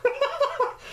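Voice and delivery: sudden high burst of laughter; amused cackle. Real, not acted. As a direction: let a squeaky laugh escape. Reacting to imagined scene.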